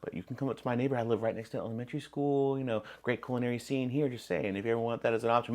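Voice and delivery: softly pitched invitation. Retold or imitated. Telling his friend he could move up north, softly. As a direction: gently sell him on moving up, quiet.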